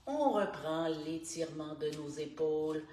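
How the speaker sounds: calm, unhurried instructor guiding each breath; guided stretching voice. Acted. Morning stretch coach guiding listeners through neck and shoulders, calm. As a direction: talk the class softly through every stretch.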